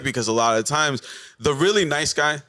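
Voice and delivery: ordinary delivery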